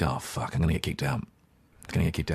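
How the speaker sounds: low, hushed aside to oneself; worried inner voice. Retold or imitated. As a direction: mutter a retold dread under your breath. Inner worry at the party, retold.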